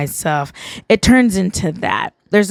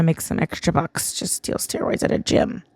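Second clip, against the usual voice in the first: whispered aside; 3 semitones lower, 8 dB quieter than usual. Real, not acted. Joking aside about dealing steroids.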